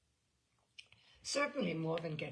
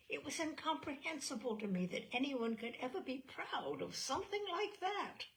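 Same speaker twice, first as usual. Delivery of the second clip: ordinary delivery, nothing marked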